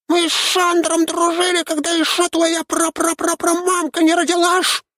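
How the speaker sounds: very high, offended creature voice; sneering panto villain. A narrator's reading. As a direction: rant like an indignant imp. Shnyrka keeps ranting.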